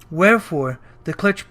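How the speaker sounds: ordinary delivery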